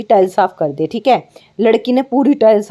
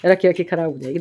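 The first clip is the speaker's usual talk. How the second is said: teasing echo of the son's excuse, a bit lower; mocking imitation; 3 semitones lower, 5 dB quieter than usual. Mother mocking her son's excuse to the viewers, teasing echo. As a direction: parrot his words back to mock him. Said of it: "देखा?".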